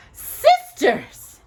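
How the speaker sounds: high, loud eager witch call; loud eager witch cry; eagerly. A narrator's reading. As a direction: summon the sisters eagerly. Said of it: "she said eagerly".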